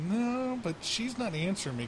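lightly mimicked whine; whiny Jag. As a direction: mimic the worried friend. Jag quoted.

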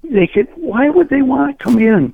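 worked up and pitched high; freaking out. Real, not acted. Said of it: "you're just freaking out at me".

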